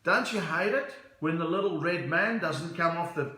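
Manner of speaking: hearty put-on Australian accent; big Australian guy. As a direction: hail a stranger as a matey Aussie. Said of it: "this big Australian guy turns around".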